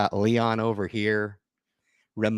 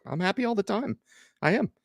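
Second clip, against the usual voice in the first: higher, bright replay of his answer; cheery reply to boss; 11 semitones higher than usual. Retold or imitated. Retelling his answer to his boss, cheerfully higher. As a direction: sing it back to the boss, higher. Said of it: "I was like".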